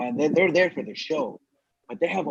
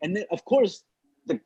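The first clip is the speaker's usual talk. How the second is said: usual speaking voice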